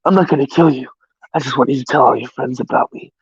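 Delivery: low, hushed menacing whisper; quiet, creepy threat, barely voiced; serial killer whisper. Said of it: "a serial killer whisper".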